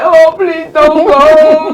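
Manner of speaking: high, slow pleading whine; mock begging plea, drawn out; hammed-up please don't leave me. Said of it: "I was more like".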